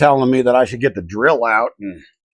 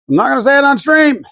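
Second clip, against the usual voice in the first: much higher, louder mock outburst; louder exasperated repeat; 13 semitones higher, 5 dB louder, faster than usual. Real, not acted. To stream chat, a higher louder exasperated shout. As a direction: shout it the second time, half joking. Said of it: "streamer repeats line louder".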